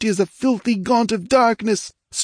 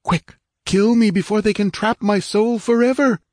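urgent dying plea; 7 semitones higher than usual. Acted. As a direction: hurry her before his soul is taken. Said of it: "his weakening voice was rational".

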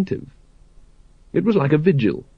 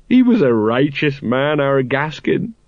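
mournful; slower than usual. Acted. Colon mourning Gaskin.

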